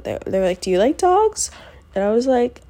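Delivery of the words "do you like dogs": lightly voiced, higher perky staffer; a hint of a perky security guard; chirpy question. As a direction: ask it brightly, pitched up. Staff asking cheerfully.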